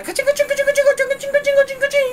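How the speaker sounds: rapid, very high chant of freezing sounds; imitated freezing noise. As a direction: squeal out a fast string of ice noises. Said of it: "青生地がさ". (高速で甲高い凍結音の真似)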